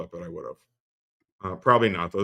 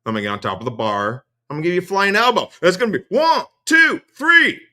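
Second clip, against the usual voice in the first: mock Mersh threat; 5 semitones higher, 6 dB louder than usual. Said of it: "And so".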